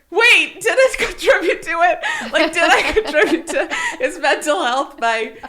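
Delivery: high, loud worry broken by laughter; laughing mock alarm. Mom wonders about harming her son, laughing in mock alarm. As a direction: fret aloud while cracking up.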